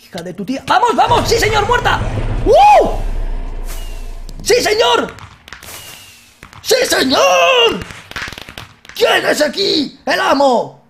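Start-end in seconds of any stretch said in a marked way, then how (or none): 0.7-5.1 high, loud triumphant yells with laughter; victory shouts. Real, not acted. Celebrates killing the witch. 6.6-7.8 high, loud, slow stretched shout; triumphant drawn-out yell; long victory yell. Real, not acted. 8.9-10.8 high, loud, slow swaggering brag; cocky loud boast; boastful. Real, not acted. Boasting after the kill.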